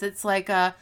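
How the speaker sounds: ordinary delivery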